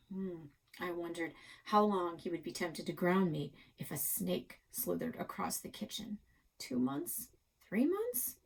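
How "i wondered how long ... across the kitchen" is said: plain reading voice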